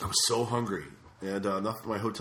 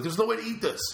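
exasperated complaint; 11 semitones higher than usual. Retold or imitated. Complaining to waiter.

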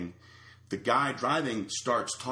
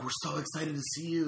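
higher-pitched imitated enthusiasm; mock gushing greeting; 6 semitones higher, 4 dB quieter than usual.

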